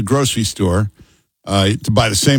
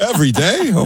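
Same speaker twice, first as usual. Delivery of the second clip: surprised; 10 semitones higher than usual. Real, not acted. S0 surprised at daily podcast.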